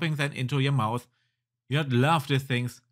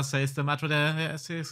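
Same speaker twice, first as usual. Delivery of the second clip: ordinary delivery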